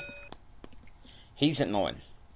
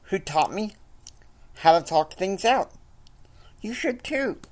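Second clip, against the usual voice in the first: higher pleading voice of Madison; pleading wife; 8 semitones higher than usual. Acted. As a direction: nag him to talk, voice pitched up.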